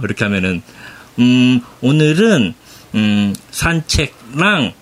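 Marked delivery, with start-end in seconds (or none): 1.2-4.7 very slow, searching for each word; halting beginner Korean. Retold or imitated. Husband imitating his Japanese wife answering about her day, haltingly. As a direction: imitate a language learner, long pauses between words. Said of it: "띄엄띄엄".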